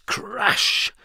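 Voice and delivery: breathy whispered crash; crash sound effect. A narrator's reading. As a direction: hiss out the crash like a noise.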